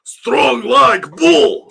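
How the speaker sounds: put-on booming, high, drawn-out Russian accent; hammed-up Russian accent at full volume. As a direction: shout it slowly like a Russian strongman. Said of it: "I'm done with my Russian accent".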